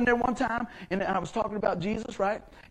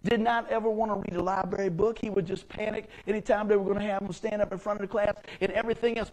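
ordinary delivery

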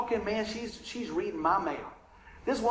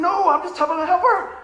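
higher and louder protest against the tempter; 9 semitones higher, 7 dB louder than usual. Retold or imitated. Preacher answers the devil's whisper with a loud protest. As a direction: push back hard, pleading innocence.

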